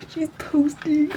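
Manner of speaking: high, drawn-out mock meltdown; crashing out. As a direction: imitate a watcher falling apart in slow motion. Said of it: "They're, like, crashing out".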